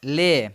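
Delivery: teacher drawing out the vowel of "ले"; syllable demonstration. Shown as a demonstration. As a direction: sound out "ले" on its own, vowel held. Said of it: "ई का sound आ रहा है".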